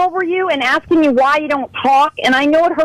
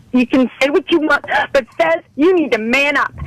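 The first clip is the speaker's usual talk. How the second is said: usual speaking voice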